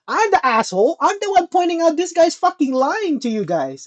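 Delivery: ordinary talk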